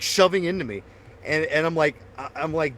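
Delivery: usual speaking voice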